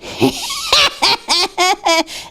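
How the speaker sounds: put-on jeering laugh at Chris; bully's staged mocking laugh; mocking fake laugh. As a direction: laugh to mock someone on purpose. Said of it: "point at Engineer Chris and laugh".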